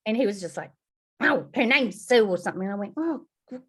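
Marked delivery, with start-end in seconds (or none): 1.2-2.2 grumpy husband. Retold or imitated. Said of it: "equally as grumpy as her". 3.0-3.6 awkward. Retold or imitated. Sheepish reply.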